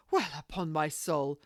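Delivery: lady exclaims in surprise after the captain leaves; exclaiming. Acted. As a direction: burst out in disbelief at the closed door. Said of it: "Lady Paris exclaimed".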